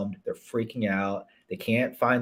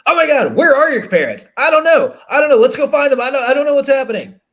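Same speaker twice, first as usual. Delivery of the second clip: panicked, much higher and louder; freaking out; 12 dB louder, faster than usual. Retold or imitated. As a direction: lose it in a flustered panic. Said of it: "we're gonna freak out like".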